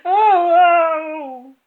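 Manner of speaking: piercing high howl of pain; a father screaming as his hat is yanked. Acted. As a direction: scream as the skin tears. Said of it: "he screamed".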